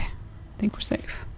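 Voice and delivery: slow breathy whisper; relieved whisper. Real, not acted. Hiding after the chase.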